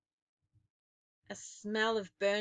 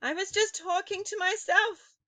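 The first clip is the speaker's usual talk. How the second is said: high frightened plea; cried; 11 semitones higher, 5 dB louder than usual. A narrator's reading. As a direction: cry it out in terror. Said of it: "cried the wretched witch".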